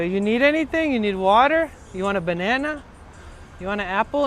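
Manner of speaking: doting old man pushing snacks, lightly voiced; imitating Luis offering. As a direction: keep offering like a fussing godfather.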